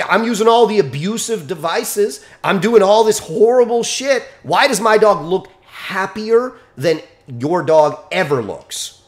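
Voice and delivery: ordinary delivery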